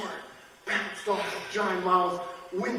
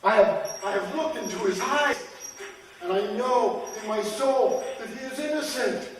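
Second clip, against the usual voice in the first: raised, impassioned pleading; earnest plea; 6 semitones higher, 5 dB louder than usual. Acted. Shoemaker vouching for the condemned man, earnest plea. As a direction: insist on it from the soul.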